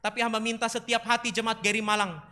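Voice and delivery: loud, steady, earnest praying; fervent prayer. Real, not acted. Preacher prays for the congregation, fervent and loud. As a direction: pray aloud with mounting intensity.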